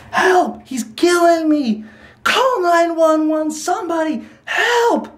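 very high mock scream for help; imitated desperate screaming. Showing why the prank is over the line, imitated screaming. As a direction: wail like a desperate victim, held back in volume. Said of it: "That's like".

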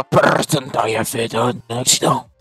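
homme de main à la voix malade, légèrement jouée; voix de cancéreux. Acted. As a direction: esquisse une voix de mafieux malade. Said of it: "avec une voix de cancéreux". (légère voix de cancéreux jouée)

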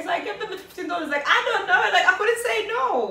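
laughing through the words, high and loud. Real, not acted. Telling her friend about the tip, laughing. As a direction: giggle it out, embarrassed at herself. Laughing at giving away money.

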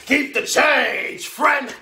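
high, loud, drawn-out holler; father's hearty call. Acted. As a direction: holler it out slowly to the cab driver. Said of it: "I hear a deep voice saying".

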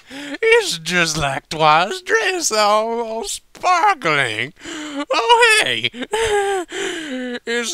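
very high, loud, slow and giggly; drunken wonder. A narrator's reading. Applejack gushing drunkenly about the sparkling sky. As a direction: marvel like a tipsy pony at the stars.

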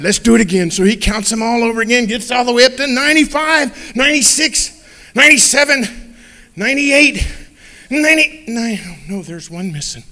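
ordinary talk

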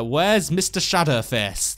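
normal talking voice

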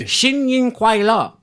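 high, loud, slow Chinese greeting; better attempted Chinese. Shown as a demonstration. Cohost shows his improved greeting, careful attempted Chinese. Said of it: "That was a lot better".

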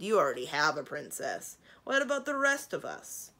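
loud dragon complaint, rising at the end; grumbling. A narrator's reading. Dragon envious of Kazul's princess, loud grumbling. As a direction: grumble loudly about being left out. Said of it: "one of the other dragons grumbled".

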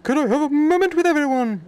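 high, loud put-on character voice; calling for attention. Game character asks everyone for a moment, high calling voice.

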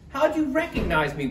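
high-pitched wailed question; mild wail. A narrator's reading. Turkey unmasked as Missus Claus, high mild wail. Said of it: "wailed Turkey".

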